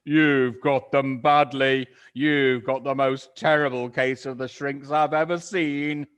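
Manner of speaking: loud mocking cry. Mister Twit jeering at his wife, loud mocking cry. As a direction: jeer at her loudly. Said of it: "cried Mister Twit".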